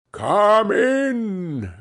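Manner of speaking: a jolly ghost's welcoming cry; exclaiming ghost. A narrator's reading. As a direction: call him in like a jolly giant. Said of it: "exclaimed the ghost".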